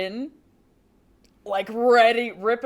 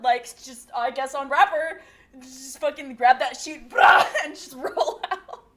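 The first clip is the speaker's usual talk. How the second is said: normal talking voice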